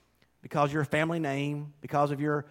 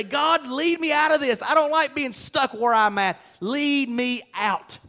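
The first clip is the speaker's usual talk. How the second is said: fervent prayer; 5 semitones higher than usual. Pretend. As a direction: cry out to God to lead you out.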